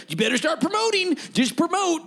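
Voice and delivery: high-pitched mock pestering voice; mock nagging. Host mocking the new form of comedy, high nagging voice. Acting out pressure to promote online.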